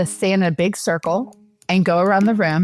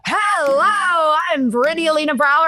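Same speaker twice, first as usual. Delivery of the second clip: bubbly self-intro; 7 semitones higher than usual. Retold or imitated. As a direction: sell yourself like the energy in the room. Retelling her own loud, bubbly introduction.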